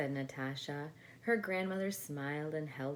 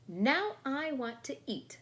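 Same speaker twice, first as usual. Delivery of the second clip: high, louder bossy demand; demanding doll; 9 semitones higher, 6 dB louder than usual. Acted. The doll demands lunch, high and bossy. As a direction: insist like a spoiled toy. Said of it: "said the doll".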